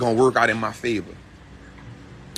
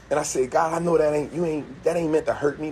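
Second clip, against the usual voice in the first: usual speaking voice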